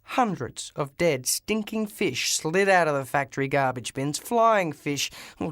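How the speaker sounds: ordinary delivery, nothing marked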